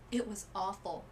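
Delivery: ordinary delivery, nothing marked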